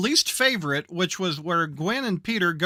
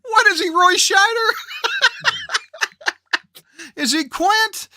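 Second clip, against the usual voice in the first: laughing; 11 semitones higher, 5 dB louder than usual. Real, not acted. Laughing mock questions.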